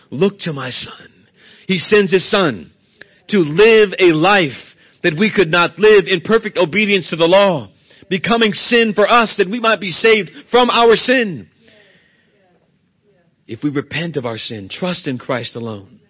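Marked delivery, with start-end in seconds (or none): 1.7-11.5 loud, rising preacher's cadence; preaching at full force. Acted. Preacher pressing the congregation on God's mercy, preaching hard. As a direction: drive every line home to the pews.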